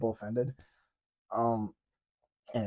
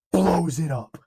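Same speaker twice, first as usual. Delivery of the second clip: louder and higher, thrilled; excited; 5 semitones higher, 9 dB louder, slower than usual. Acted. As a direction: hype it up like the big moment. Hype retelling of the hero's explosion.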